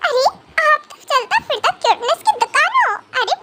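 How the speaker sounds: ordinary talk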